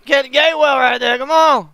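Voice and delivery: excited urging. Real, not acted. Fan urging the player on during the play. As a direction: push him on from the couch.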